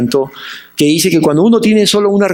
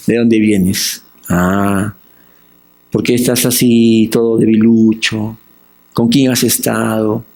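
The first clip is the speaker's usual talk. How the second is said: much lower, tender questioning; the Good Shepherd asks a weak sheep; gentle shepherd voice; 8 semitones lower than usual. Retold or imitated. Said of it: "Él sabe, dice". (buen pastor que pregunta con ternura, voz más grave)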